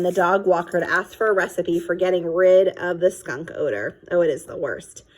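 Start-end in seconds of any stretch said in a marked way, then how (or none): none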